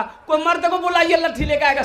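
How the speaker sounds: high, womanish call for help, imitated; mock him shrieking along with the women, pitched high; mimicked crying out. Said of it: "ये भी चिल्ला रहा". (औरतों जैसी चीख-पुकार की नक़ल)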